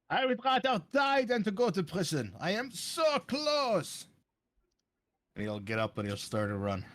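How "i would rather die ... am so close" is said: higher, slowing defiant villain. Acted. Villain defiant before fleeing.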